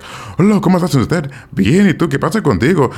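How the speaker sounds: high-pitched caricature Mexican Spanish accent; exaggerated Mexican accent. Shown as a demonstration. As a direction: put on a hammed-up Mexican accent. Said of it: "speak Spanish with a Mexican accent".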